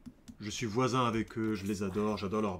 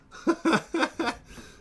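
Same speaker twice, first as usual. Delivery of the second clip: high giggle; 5 dB louder than usual. Real, not acted. Laughs after the accent bit.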